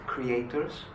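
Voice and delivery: usual speaking voice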